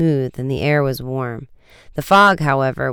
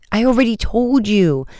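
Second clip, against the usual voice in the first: higher, insistent protest; dogged; 8 semitones higher than usual. A narrator's reading. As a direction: dig in stubbornly, pitched up. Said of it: "the man said doggedly".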